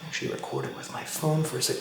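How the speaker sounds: hushed whisper. Real, not acted. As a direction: whisper so nothing hears you. Whispering while filming scary scene.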